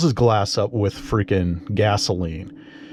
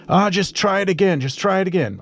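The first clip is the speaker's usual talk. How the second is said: much higher, impatient mimicked voice; mild mock yelling; 10 semitones higher, faster than usual. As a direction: imitate a pushy man brushing off objections. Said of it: "He's screaming at me to start it".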